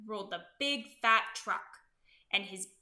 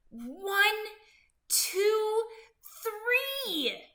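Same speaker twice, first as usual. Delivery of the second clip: building count; 9 semitones higher, 4 dB louder, much slower than usual. A narrator's reading. Counting the final push.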